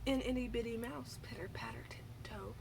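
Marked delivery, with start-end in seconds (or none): 1.2-2.6 breathy tiptoe whisper. A narrator's reading. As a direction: whisper it like tiny footsteps. Tiptoeing mouse described.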